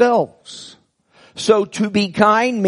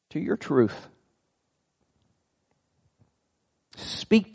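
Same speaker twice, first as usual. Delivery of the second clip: hushed, low-pitched prayer, sinking softer toward the amen; 6 semitones lower, 6 dB quieter than usual. Real, not acted. Preacher asks the Father to speak to the church, praying quietly. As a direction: pray gently, with long pauses between phrases.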